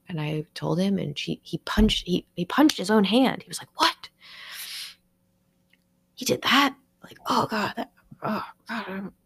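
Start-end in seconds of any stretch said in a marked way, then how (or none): 3.8-9.1 restrained grumbling. Retold or imitated. Retelling his shocked reaction to the news, restrained grumbling. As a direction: imitate stifled, indignant disbelief, higher-pitched. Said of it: "kind of grumbling and trying not to make a scene".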